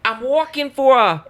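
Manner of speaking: high, loud failed New York impression; clumsy mock New York accent; bad New York accent. Shown as a demonstration. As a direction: strain for a New Yorker and miss. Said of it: "Try a New York accent".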